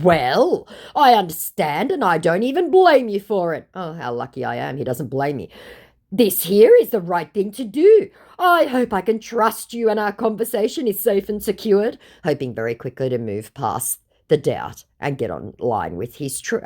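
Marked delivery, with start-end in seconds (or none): 0.0-3.7 raised, pleading read of the scammer's message; playing the fake Hugh Jackman begging fans to trust him. Reading out the fake star's message in a higher put-on voice. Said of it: "To which my scammer said". 6.1-12.0 raised, pleading read of the scammer's message; playing the fake Hugh Jackman begging fans to trust him. Reading out the fake star's message in a higher put-on voice. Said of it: "To which my scammer said".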